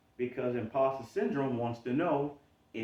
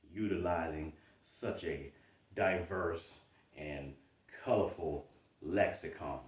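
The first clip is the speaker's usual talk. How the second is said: ordinary talk, nothing marked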